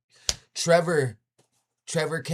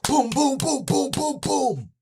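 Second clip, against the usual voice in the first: much higher, louder booms, hammered out; imitated door pounding; 6 dB louder, slower than usual. Dad pounds on the door, mimicked in loud booms. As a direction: bang out each boom like a fist on a door.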